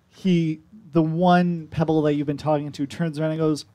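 ordinary talk, nothing marked

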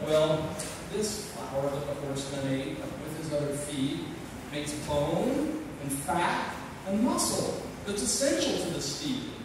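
poem recited in a cowboy voice; cowboy drawl. Acted. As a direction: recite it like a cowpoke at the campfire.

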